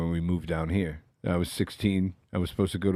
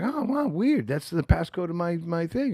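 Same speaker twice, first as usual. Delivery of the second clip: high, put-on mother's voice; imitated surprised mom; 8 semitones higher than usual. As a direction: play an unsuspecting mom, pitched way up. Acting out his unsuspecting mother.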